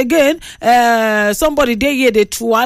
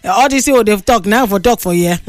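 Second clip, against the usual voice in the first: normal talking voice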